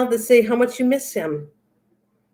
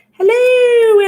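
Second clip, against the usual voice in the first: high, loud, drawn-out hooted greeting; Missus Doubtfire; 13 semitones higher, 6 dB louder than usual. Acted. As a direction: sing out a theatrical, high-pitched hello. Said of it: "Missus Doubtfire".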